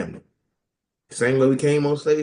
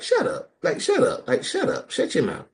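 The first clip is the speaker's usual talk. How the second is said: dismissive; 11 semitones higher than usual. Pretend. Dismissing a childless advisor.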